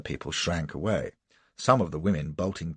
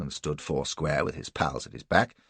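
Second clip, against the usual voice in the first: usual speaking voice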